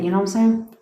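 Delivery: normal talking voice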